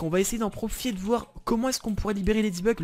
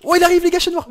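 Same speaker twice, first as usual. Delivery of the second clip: alarmed shout; 11 semitones higher, 10 dB louder than usual. Real, not acted. Spots Chat Noir coming, alarmed.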